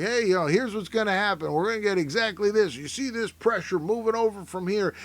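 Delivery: a hint of an overconfident TV forecaster; weatherman. Weatherman promising viewers exactly what will happen, lightly mocked confident forecast. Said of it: "the weathermen are just like".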